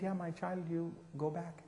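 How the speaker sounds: soft, calm and patient. Retold or imitated. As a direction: say it quietly, like settled advice. Mother Teresa repeats her answer.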